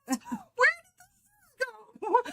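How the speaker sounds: halting, high-pitched mock puzzlement; mock bewilderment. Scolded daughter wondering about the shoes, mock bewildered. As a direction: act baffled at yourself, amused. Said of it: "And I'm like".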